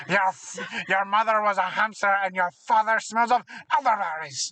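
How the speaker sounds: taunting bad French accent; Monty Python taunt in French accent; terrible French accent. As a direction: fling the insult in mock French. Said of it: "my terrible French accent".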